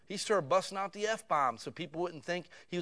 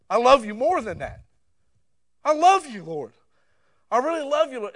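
loud, pleading prayer, pitched up; impassioned prayer; 4 semitones higher, 9 dB louder than usual. Retold or imitated. A repentant believer cries out loud in prayer to the Lord. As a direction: cry out your love to the Lord.

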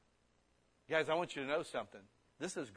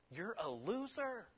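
mock verdict; 6 semitones higher, 5 dB quieter, slower than usual. Preacher's mocking verdict.

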